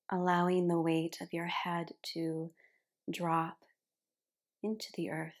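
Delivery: unhurried meditation cueing, letting each phrase settle; guided relaxation. Real, not acted. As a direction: guide them gently into rest, phrase by phrase. Guides relaxation at the start of yin yoga.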